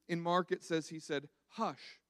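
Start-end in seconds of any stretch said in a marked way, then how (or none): 1.5-2.0 soft hush. Retold or imitated. Jesus speaks to the storm.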